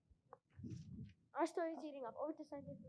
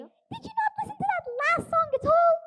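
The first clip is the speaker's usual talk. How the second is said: high, loud exasperation; fed up with the singers; exasperated; 10 semitones higher, 7 dB louder than usual. Acted.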